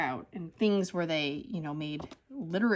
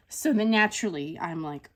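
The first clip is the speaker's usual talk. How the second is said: ordinary delivery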